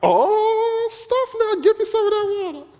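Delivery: high, excited woman's voice; hammed-up high-pitched excited woman; eager woman. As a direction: squeal it out, grabbing for the water. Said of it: "She like".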